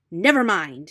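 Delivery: loud angry outburst; mildly angry fox; angry cry. A narrator's reading. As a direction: spit it out in fury. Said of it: "he cried angrily".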